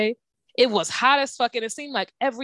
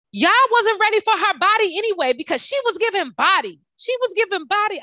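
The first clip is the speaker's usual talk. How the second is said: much higher, louder hyped-up sass; sassy friend; 11 semitones higher, 3 dB louder than usual. Retold or imitated. Friend sassing the staff while walking out, much higher and hyped. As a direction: hype her body up as you walk out.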